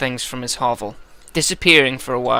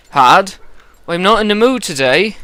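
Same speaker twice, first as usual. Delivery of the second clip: higher, louder West Country snap; irritated West Country Eirik; 7 semitones higher, 6 dB louder than usual. Acted. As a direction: brush him off in a West Country burr. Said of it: "I think West Country for this guy".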